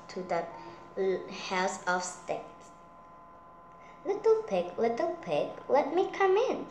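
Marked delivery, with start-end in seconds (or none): 4.1-6.7 high squeaky wolf calling. Acted. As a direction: call out high like a playful wolf. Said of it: "said the wolf".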